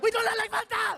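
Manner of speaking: put-on loud high German accent, indignant; angry German accent. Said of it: "the guy was like".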